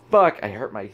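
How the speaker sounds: usual speaking voice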